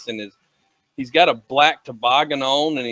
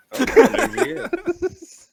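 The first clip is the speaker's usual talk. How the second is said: high, slow words through laughter; laughing; 6 dB louder, slower than usual. Real, not acted. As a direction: laugh out the whole line. Laughing at a friend's answer.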